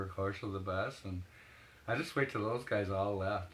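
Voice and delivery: ordinary talk, nothing marked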